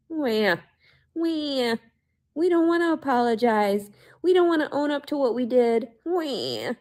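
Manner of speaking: high whiny wah, wah; mock crybaby. Mocking the people who won't apologize, whiny and high. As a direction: whine like a baby.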